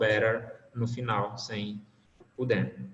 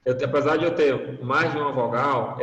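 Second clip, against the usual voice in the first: normal talking voice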